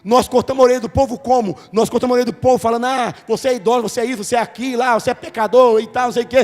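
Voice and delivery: loud, driving preacher's climax; full-force preaching. Real, not acted. Pastor closing the sermon to the church, loud and fervent. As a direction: preach it hard to the congregation.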